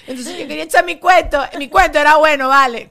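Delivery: high, loud mock outrage; indignant protest. Interrupted friend demands to finish her story, indignant. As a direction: protest loudly like a storyteller robbed of the floor. Acts out being cut off mid-story.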